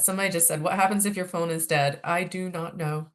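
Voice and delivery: ordinary delivery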